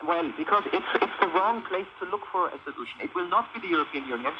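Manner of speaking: ordinary delivery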